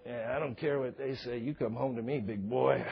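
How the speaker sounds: playful mock sultry wife; seductive wife. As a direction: coax him home, low and soft. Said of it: "his wife's going".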